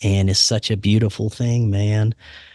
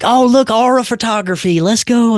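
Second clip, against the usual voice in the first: high, louder mock of an excited wife; 11 semitones higher, 3 dB louder than usual. Wife spotting aura photography at the fair, mock excited and high. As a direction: play up the wife's eager delight. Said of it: "she's like".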